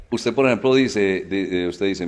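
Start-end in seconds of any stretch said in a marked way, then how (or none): none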